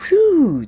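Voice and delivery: sharp high exhale of relief; loud high whew after moving. Real, not acted. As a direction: blow out a breathless whew. Host catching breath after warm-up.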